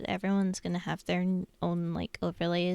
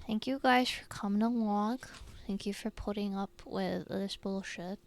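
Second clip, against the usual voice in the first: usual speaking voice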